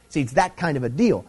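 usual speaking voice

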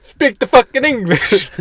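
hammy foreign bark, slow and high; mock Italian-ish accent. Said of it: "more Italian".

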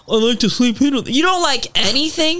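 lightly hammed mock of the date. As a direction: parody the boring date. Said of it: "than you are like".

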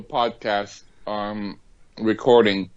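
talking while chewing; mouth full. Real, not acted. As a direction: say it through a mouthful of food. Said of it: "What are you munching on".

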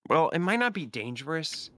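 Arazan. Acted. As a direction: lift the pitch for the character. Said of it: "I haven't done this voice in a while".

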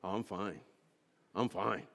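low, hushed, giving nothing away; mock-stoic murmur; understated Norwegian reserve. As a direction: play down the trouble, low and soft. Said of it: "if a Norwegian's going through a really".

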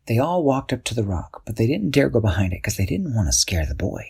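ordinary delivery, nothing marked

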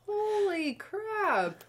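high, slow stunned exclamation; amazed. Real, not acted. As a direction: drag it out in awe. Amazed at the age.

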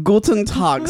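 a German greeting, with a hint of a German accent; mock German accent. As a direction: greet the listeners like a German, lightly. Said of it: "say something in a German accent".

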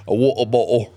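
mock British. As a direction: put on a silly British accent. Trading British accent phrases.

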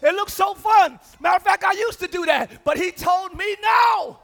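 loud, high shouted preaching; shouting sermon climax; full-force preaching. Acted. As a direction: preach it hard to the church.

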